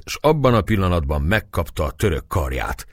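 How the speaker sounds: usual speaking voice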